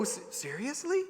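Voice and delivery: softly incredulous, rising question; mock innocent disbelief. Child questioning the power of a piece of paper, mildly incredulous. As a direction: ask it like a kid who can't believe it. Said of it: "You know, like, to go".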